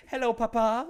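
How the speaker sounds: high, loud laughing puppet voice; goofy puppet. Retold or imitated. Pinocchio greeting papa with a goofy face, high voice. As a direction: chirp it like a goofy doll.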